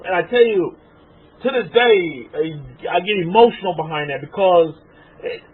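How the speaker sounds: normal talking voice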